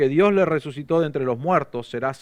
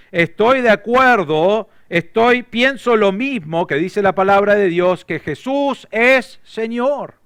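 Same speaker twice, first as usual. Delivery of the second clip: raised, higher, slow avowal; fervent confession; 6 semitones higher, 6 dB louder, slower than usual. Acted. Preacher voicing the believer's confession, fervent and raised. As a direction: proclaim it like your own vow.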